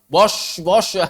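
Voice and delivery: Bosh with rounded pouted lips; mock the pouting Bosh; pouting shin. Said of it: "mengucapkan shin monyong-monyongan".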